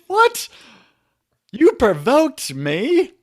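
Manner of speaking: high, outraged disbelief; mock-shocked husband, high and loud; incredulous quote. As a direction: squeak out a stunned what.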